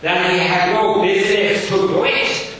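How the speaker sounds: loud, high, stern schoolmaster. Acted. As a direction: bark it like a strict Victorian teacher. Gradgrind voiced in reading.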